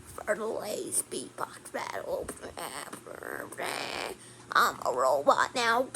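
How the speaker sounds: a deep, quiet mechanical villain growl; robot voice. Retold or imitated. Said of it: "This is my robot voice".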